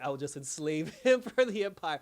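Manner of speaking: breaking into a laugh mid-line; can't keep a straight face on enslave; laughing through quote. Retold or imitated. Recapping Kara's villain line to co-hosts, laughing through it.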